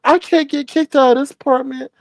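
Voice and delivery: high mock-earnest voice; Tracy voice. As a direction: play her pleading to stay housed. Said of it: "she's like".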